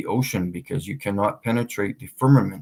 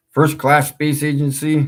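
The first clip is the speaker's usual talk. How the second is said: loud, high mocking repeat; 6 semitones higher, 6 dB louder than usual. Shown as a demonstration. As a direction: sneer at the name.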